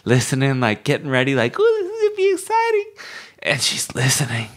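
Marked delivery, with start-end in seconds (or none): 1.6-2.9 very high, rushed squeal of anticipation; mock-excited high squeaky chatter; giddy crazier friend. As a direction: imitate a giddy friend, pitched way up and fast. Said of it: "a crazier friend".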